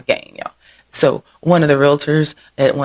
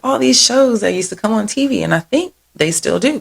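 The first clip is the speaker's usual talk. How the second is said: normal talking voice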